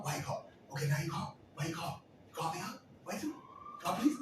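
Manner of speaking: high, choppy pestering questions; mock badgering in short bursts; rapid-fire questioning. Several callers questioned at once.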